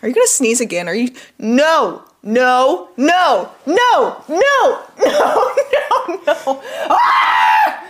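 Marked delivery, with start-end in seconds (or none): 1.4-6.6 each no climbing higher in pitch; rising panicked nos. Real, not acted. Fighting off a sneeze. 6.9-7.8 loud, high laughing yelp; laughing shout. Real, not acted. Sneeze bursts out.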